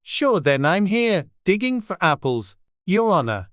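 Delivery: lightly voiced Irish servant; Irish accent. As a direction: Pat answering the rabbit in mild Irish. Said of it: "a voice she had never heard before".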